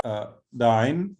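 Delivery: दाइन drawn out slowly with a rise; slow drill word. Shown as a demonstration. Teacher models दाइन slowly for the class. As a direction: lengthen दाइन, lifting the end.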